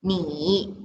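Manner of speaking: "你" in a low, dipping third tone; teacher models "你" in third tone. Shown as a demonstration. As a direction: say "你" with a low dip. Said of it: "N I with tone number three is".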